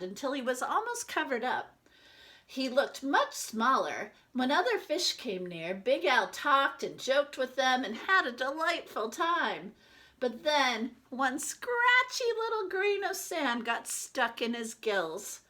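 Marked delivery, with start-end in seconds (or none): none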